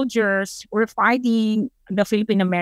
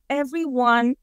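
ordinary talk

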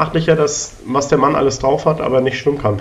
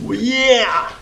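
triumphant cheer; 8 semitones higher, much slower than usual. Real, not acted. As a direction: celebrate the jump. Cheering after success.

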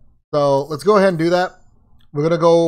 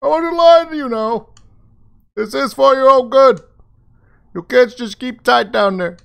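higher and louder call through door; muffled Tolliver through door; 9 semitones higher, 5 dB louder than usual. Acted. Player voices Tolliver behind door.

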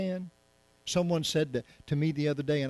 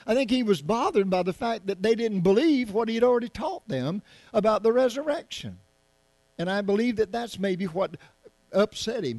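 higher, louder preaching build; fiery preaching; 8 semitones higher, 6 dB louder than usual. Real, not acted. Preacher pressing the congregation on Jesus's anger, loud and high.

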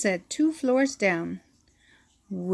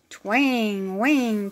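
plain reading voice

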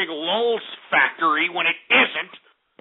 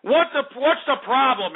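exasperated demand; 6 semitones higher than usual. Real, not acted. Demands answer from engineer.

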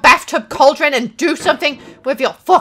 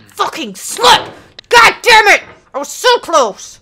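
high, loud frustrated outburst; angry cursing; 8 semitones higher, 5 dB louder than usual. Real, not acted. Gamer cursing a near miss, angry outburst. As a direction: spit the curse out high.

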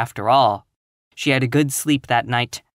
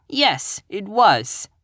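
higher, defiant schoolboy reply; insolent; 8 semitones higher, much slower than usual. A narrator's reading. Pupil answering angry teacher, insolent. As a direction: toss it back without shame. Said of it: "said Anthony insolently".

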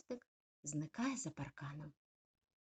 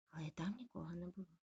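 ordinary delivery

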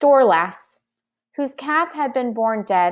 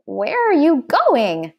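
higher, drawn-out call; mild yell; 5 semitones higher, slower than usual. A narrator's reading. Boy to the dragonling turning back, mildly yelling. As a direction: call after a runaway, holding back the volume. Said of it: "yelled Derek".

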